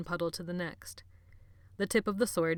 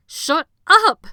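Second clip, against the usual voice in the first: full scream, much higher and louder; 12 semitones higher, 21 dB louder than usual. A narrator's reading. Jonathan screaming at Benny, full-force scream. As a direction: scream with everything. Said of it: "Jonathan screamed".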